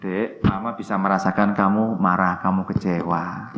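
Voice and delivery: put-on mother soothing a hurt child, softly; speaking with love. Shown as a demonstration. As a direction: comfort the little one gently. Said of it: "ngomong sepenuh cinta".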